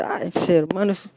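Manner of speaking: mock someone looking down on a humble preacher; dismissive churchgoer. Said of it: "Viene alguien todo humilde a predicar y dice". (desdén burlón, en voz baja)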